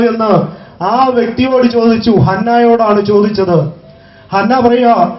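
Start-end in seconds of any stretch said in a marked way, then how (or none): none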